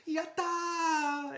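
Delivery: high, loud, drawn-out triumphant cry; full-throated celebratory shout, imitating the winner; imitated victory yell. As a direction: yell it like the champion who just won. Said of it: "then he's yelling".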